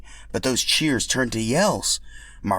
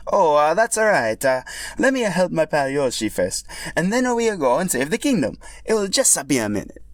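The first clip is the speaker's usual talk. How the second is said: higher, louder, sheepish hero; sheepish Mario voice; 8 semitones higher, 4 dB louder than usual. Acted. Mario apologizing to his companion, higher and sheepish. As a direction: brush off the guilt cheerfully.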